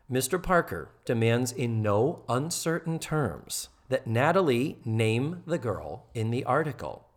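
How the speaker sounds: ordinary delivery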